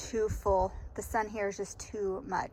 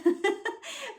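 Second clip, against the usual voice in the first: high laugh sliding down; falling laugh; 13 semitones higher than usual. Real, not acted. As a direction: let a laugh tumble down. Laughing at own hobby.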